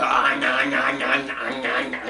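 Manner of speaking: chewing noises, nah nah nah; playful chomping sounds; imitated gnawing. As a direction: mime gnawing on hard candy. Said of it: "you go".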